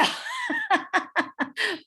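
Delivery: short, high burst of laughter; a quick amused chuckle. Real, not acted. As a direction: laugh at your own cheeky line. Laughs at her own jab.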